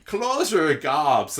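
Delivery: high, loud, laughing mock Irish lilt; laughing mock Irish accent, slow then rushing; fake Irish accent. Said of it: "fake Irish accents".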